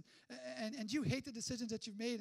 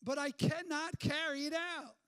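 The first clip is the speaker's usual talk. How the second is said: emphatic quote; 3 semitones higher, 7 dB louder than usual. Acted. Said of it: "He says".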